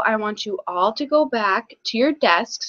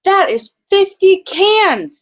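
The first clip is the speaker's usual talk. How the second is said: higher, louder and slow, amazed; excited announcing; 9 semitones higher, 5 dB louder, slower than usual. Acted. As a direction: announce the total with wonder. Child announcing big count.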